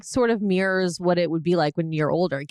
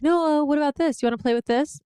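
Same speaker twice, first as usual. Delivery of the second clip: put-on higher, sing-song coaxing to a toddler; imitating Jeff coaxing; 8 semitones higher than usual. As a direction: coax the child like an eager uncle. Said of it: "was like".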